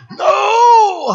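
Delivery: high, drawn-out mock wail; devil's protesting cry. Devil refusing the preacher's command, high hammed-up wail.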